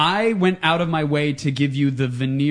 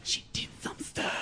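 whispering; 7 semitones lower, 10 dB quieter than usual. Retold or imitated. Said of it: "her college friends were like".

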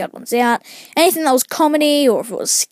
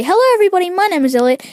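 high, quick burst of greeting; enthusiastic video voice; 8 semitones higher, 3 dB louder, much faster than usual. Shown as a demonstration. Showing how he wants videos to open, enthusiastic greeting. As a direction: leap up on the hello like a hyped YouTuber. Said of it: "I want it to be".

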